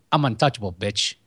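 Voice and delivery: lightly voiced sleazy Chicagoan muttering his catchphrase; Rob Lowe impression. Podcaster mimicking Rob Lowe's movie line, lightly voiced Chicago tough. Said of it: "he kinda talks like this".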